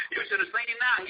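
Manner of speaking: normal talking voice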